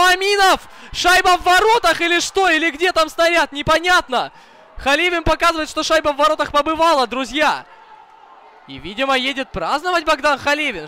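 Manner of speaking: high, loud, urgent goal call; excited hockey goal call. Real, not acted. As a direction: yell it out as the puck goes in. Possible goal, live commentary.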